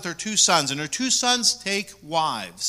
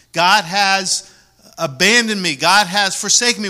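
anguished cry; 4 semitones higher, 8 dB louder than usual. Acted. Naomi's anguished complaint, preached.

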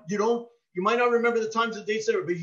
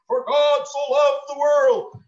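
put-on slow, louder, higher sing-song recital of small kids; child reciting; 7 semitones higher, 7 dB louder, slower than usual. Preacher mimicking his toddlers reciting a verse to family, slow loud sing-song.

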